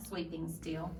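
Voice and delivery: ordinary delivery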